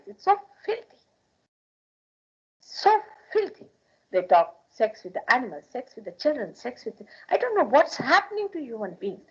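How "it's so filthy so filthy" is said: disgusted. Real, not acted. Preacher condemning talk of sex.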